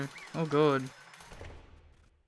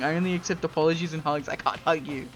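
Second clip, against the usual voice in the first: ordinary delivery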